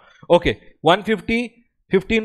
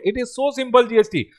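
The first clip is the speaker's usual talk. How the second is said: ordinary talk, nothing marked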